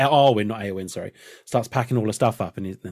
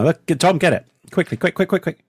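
high, rushed calls of quick; excited urging; 6 semitones higher, 3 dB louder than usual. Real, not acted. Friend urging Tom to grab it quickly, excited. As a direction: hurry someone to grab it. Urgent: something on screen to catch.

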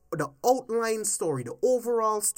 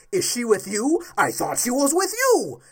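louder, mocking Batman line; a hint of Batman, mocked louder; quoting Batman; 5 semitones higher, 6 dB louder than usual. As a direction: sneer the quote back at him. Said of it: "you're going to ask".

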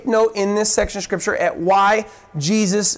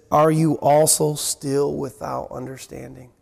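lower, weary, disappointed rebuke; rereading Jesus's rebuke to the disciples, lower and wearier; frustrated Jesus; 7 semitones lower, 3 dB quieter than usual. Retold or imitated. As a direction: sigh it out as a tired reproach. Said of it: "I could just hear the tone of the voice of the Lord there".

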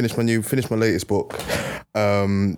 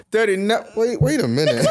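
high mock surprise; 10 semitones higher than usual. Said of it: "she went".

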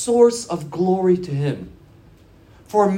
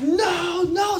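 mock screamed plea, pitched way up; retold screaming; 12 semitones higher than usual. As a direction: imitate a kid wailing in protest. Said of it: "screaming at the top of his lungs".

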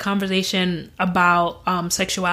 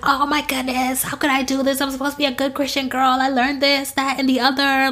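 mildly voiced distraught prayer, higher; retold sobbing plea; 6 semitones higher than usual. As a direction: replay her tearful panic, lightly. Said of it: "I'm a blubbering mess".

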